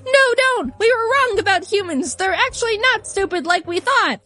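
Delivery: high, earnest plea voiced lightly; mock girlish pleading; pleading Duna. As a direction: beg the king to stop. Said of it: "And Dune is like".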